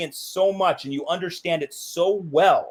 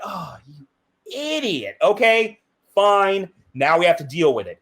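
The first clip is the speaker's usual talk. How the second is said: exasperated; 3 semitones higher than usual. Acted. A jumper's body scolding its owner for going anyway, exasperated. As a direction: give in with mock annoyance. Body's exasperated reply, acted out.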